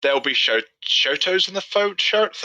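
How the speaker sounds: words slurred by loose teeth; garbled with teeth slipping; loose dentures. Real, not acted. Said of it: "let me put my teeth back in".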